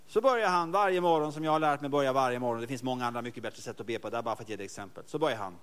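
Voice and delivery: ordinary talk, nothing marked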